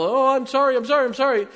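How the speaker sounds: loud, high, panicked string of sorries; child pleading with a parent to avoid paddling, shrill panic; frantic caught kid. Retold or imitated. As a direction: beg like a child about to be paddled. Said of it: "You say that so you don't get paddled".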